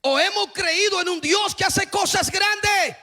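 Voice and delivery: climbing sermon shout; fiery preaching. Acted. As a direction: preach it out to the whole church at full force. Preacher proclaims.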